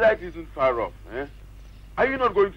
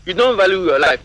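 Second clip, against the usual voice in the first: loud threat; 3 semitones higher, 7 dB louder than usual. Acted. Threatening the silent figure.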